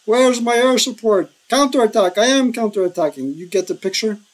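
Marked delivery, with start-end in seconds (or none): 0.0-1.3 higher-pitched acted yell into a phone; mock phone scream. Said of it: "screaming over the phone". 1.5-3.3 higher-pitched, hammed-up battlefield yell; mock phone scream. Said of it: "screaming over the phone".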